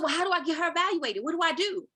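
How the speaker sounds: high, fast, urgent questions; anxious pleading. Retold or imitated. Mother pleading with the school team, high anxious rush. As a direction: press them for answers. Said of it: "I said".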